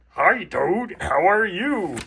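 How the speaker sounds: bouncy friendly monster hello; Grover impression. Grover greeting Toad at the book's end. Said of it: "That's my best Grover impression".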